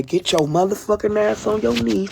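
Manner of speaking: voice of God. Acted. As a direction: lightly play a profane, commanding deity. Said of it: "He say".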